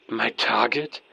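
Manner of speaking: usual speaking voice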